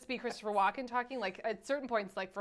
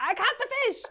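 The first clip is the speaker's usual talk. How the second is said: mock excited fisherman; 10 semitones higher, 5 dB louder than usual. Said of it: "do, like".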